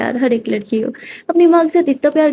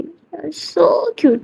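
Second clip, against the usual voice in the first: fond murmur; 5 semitones higher, 3 dB quieter, much slower than usual. Acted. Kiran watches Simran leave, smiling.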